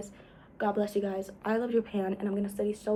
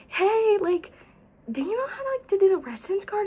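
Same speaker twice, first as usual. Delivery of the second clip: high, eager question; bright friendly ask; 10 semitones higher than usual. Retold or imitated. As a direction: ask a stranger sweetly in a high voice. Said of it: "But I was like".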